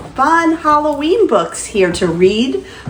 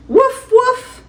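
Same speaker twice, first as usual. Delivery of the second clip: high, yippy little puppy bark; squeaky cartoon puppy woof; 12 semitones higher than usual. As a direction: bark like a tiny playful puppy. Puppy barking in story.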